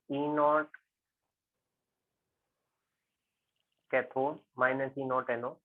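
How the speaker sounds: slow, word-by-word formula dictation; teacher dictating the cell equation slowly. Real, not acted. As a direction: spell it out slowly as you write it on the board. Dictating formula while writing.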